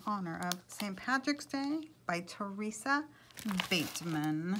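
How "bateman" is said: ordinary delivery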